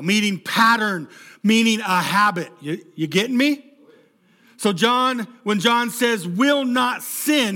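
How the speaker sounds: loud, impassioned preacher; preaching. Acted. Pastor preaching hard to the church, loud and impassioned. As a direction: drive the point home to the congregation.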